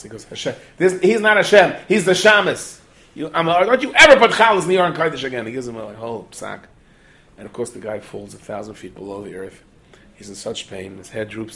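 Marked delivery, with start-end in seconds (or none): 0.2-5.5 loud, high-pitched exasperated telling-off; angry yelling. Acted. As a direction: shout down a foolish idea. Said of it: "why do you have to yell at him".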